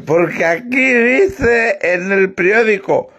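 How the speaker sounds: put-on slow, slurred drunk reading; drunkard. Drunk reads the newspaper to the priest, slurring slowly. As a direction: labour through each word like a drunk. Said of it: "Dice el borracho".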